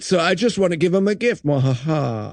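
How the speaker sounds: ends in a low evil laugh; mustache twirling villain. Acted. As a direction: sneak the villain cackle in at the end. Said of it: "again, you hear".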